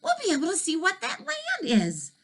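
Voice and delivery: a high, bright explanation that builds; excited to have figured it out; eager boy. Acted. Elmer tells the dragon they are near land, eager.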